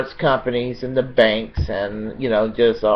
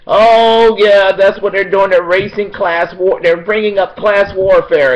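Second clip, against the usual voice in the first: much higher and louder, sneering mimicry of a TV pundit; mocking pundit voice; 9 semitones higher, 9 dB louder than usual. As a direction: ham up a smug pundit you despise. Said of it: "pundits out there and these spokespeople out there saying".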